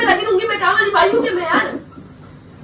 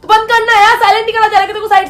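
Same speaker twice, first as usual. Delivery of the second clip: loud, heated, raised pitch; angry demand; 3 semitones higher, 4 dB louder than usual. Real, not acted. Wife demands the phone be switched off, angrily. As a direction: insist on it with open irritation.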